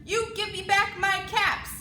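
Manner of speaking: loud scolding. Acted. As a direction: scold the monkeys. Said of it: "he said".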